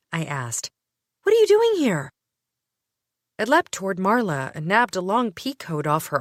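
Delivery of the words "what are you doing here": much higher, loud startled question; shocked. Acted. Narrator shocked to find Gray at her door, startled. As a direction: caught off guard at the door.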